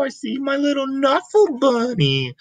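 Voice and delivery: squeaky high playful voice; a little voice for the toy. Acted. Reading to a child, a high playful toy voice. Adult voicing a toy in story.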